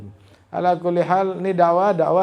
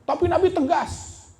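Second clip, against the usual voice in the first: emphatic; 6 dB louder than usual. Real, not acted. Preacher stresses the Prophet's firmness.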